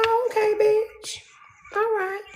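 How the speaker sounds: high, mocking sarcastic squeal. Brushing off the judge in a high sarcastic voice. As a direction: sneer it up high and sassy.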